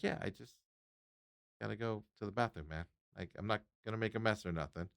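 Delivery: hushed reply to the cleaning guy; quiet reply. Retold or imitated. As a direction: say it softly, a bit sheepish. Retells quiet reply to janitor.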